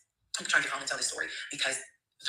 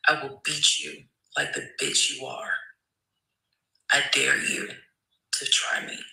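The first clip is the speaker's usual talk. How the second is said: cold threat; slower than usual. Retold or imitated. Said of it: "And I literally said".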